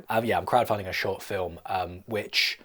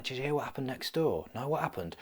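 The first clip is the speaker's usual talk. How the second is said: gossiping animals; 4 semitones higher, 6 dB quieter than usual. Acted. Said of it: "woodland animals gossiping".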